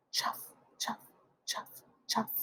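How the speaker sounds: whispered "chuff, chuff" like soft footsteps. A narrator's reading. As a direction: breathe the steps softly. Shoes on path, soft.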